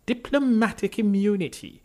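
high, loud schoolteacher's recitation; village schoolteacher. A narrator's reading. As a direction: call it out like a teacher to a village class. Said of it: "as if you're a school teacher in a village class".

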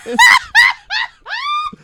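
high screaming burst of laughter; shrieking laugh. Real, not acted. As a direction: howl with laughter at your own joke. Laughing at own erection pun.

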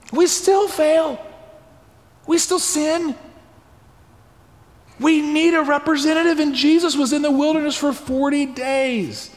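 high, urgent sermon voice; impassioned preaching. Real, not acted. Preacher telling the congregation we need a representative, impassioned.

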